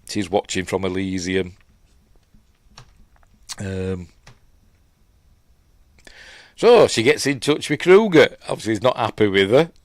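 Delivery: ordinary talk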